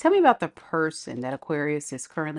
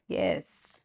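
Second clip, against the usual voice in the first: normal talking voice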